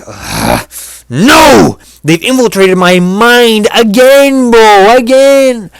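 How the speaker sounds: loud, high, slow wail; hammed-up despair; mock panic. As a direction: cry out as if your mind is taken. Mock panic over mind control.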